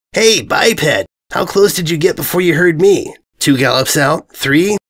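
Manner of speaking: lightly voiced brash mare teasing; cocky taunt. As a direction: needle him with swagger.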